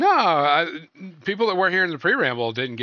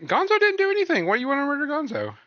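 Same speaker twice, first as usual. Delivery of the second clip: mock outrage; 10 semitones higher, faster than usual. Mock outrage defending Gonzo.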